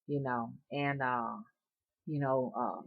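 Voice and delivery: ordinary talk, nothing marked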